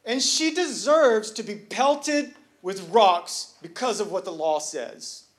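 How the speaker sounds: full-force preaching. Acted. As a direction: preach it, building to the crowd's amens.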